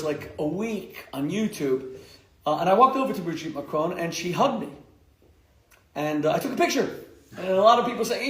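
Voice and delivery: normal talking voice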